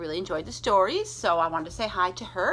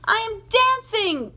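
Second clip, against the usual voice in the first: much higher, louder cry of delight; joyful shout; 12 semitones higher, 7 dB louder than usual. Acted. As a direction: shout it out in pure joy. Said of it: "Gerald cried".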